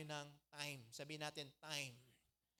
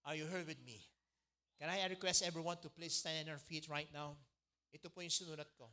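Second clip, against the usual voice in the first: normal talking voice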